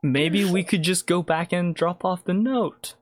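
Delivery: high-pitched, tentative henchman; hesitant henchman. Acted. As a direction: float a timid suggestion in a high voice. Game master plays hapless kidnapper.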